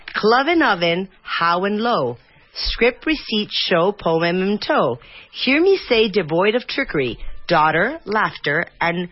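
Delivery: slow, measured poem reading with falling line ends; poem recital. Acted. Host reads a tricky English pronunciation poem, careful recital. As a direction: read each rhyming pair slowly and carefully.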